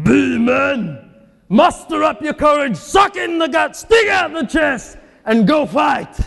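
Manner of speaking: high, loud captain rousing his troops; rallying shout. Acted. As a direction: bark it like a general before battle, slowing at the end. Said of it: "the captain of these Philistines says".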